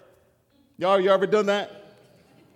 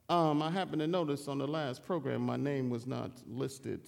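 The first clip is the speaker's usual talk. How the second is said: petty grumble; 6 semitones lower, 4 dB quieter than usual. Retold or imitated. A hint of a slighted church member grumbling about the program, low and soft. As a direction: sulk about being left off the program.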